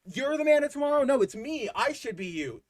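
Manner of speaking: a lightly voiced, jealous Lex insisting; mock petulant Lex. Mocking Lex's usual rivalry.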